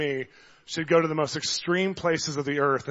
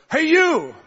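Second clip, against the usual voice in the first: high, loud call across a coffee shop; calling out across the room; 11 semitones higher, 7 dB louder than usual. Retold or imitated. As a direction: call her out across the café. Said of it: "I had to say it intensely 'cause it's across the place".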